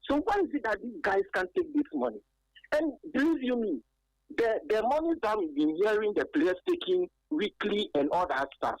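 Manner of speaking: normal talking voice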